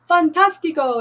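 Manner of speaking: high, loud cry of delight; the monkey bursting with joy; excited exclaim. Acted. The monkey cheers the turtle's idea, loud and thrilled. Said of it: "exclama el mono".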